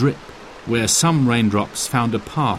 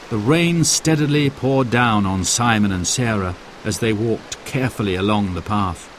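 ordinary delivery, nothing marked

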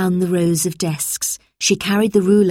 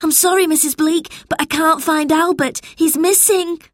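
put-on high, distressed boy's voice; upset child crying out; anxious cry; 10 semitones higher than usual. A narrator's reading. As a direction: plead with the teacher, high and worried. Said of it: "cried George".

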